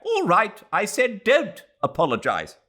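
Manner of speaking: loud, high cutting retort; sharp rebuff. Acted. Mrs Turton cutting off Mr Heslop, loud and sharp. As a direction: cut him short.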